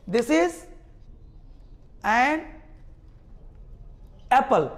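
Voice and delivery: this is, an, Apple with long pauses between; word by word. Shown as a demonstration. Teacher separating words so class hears the n. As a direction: pause after every word. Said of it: "एन का sound तो बोल के देखो".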